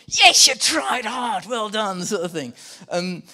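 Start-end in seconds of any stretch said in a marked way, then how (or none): 0.1-2.0 loud, high mock cheer, first words stretched; excited dad. Preacher as dad praising a poor report, excited cheer. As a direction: cheer like an overjoyed dad, hammed up. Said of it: "he's like an excited dad here".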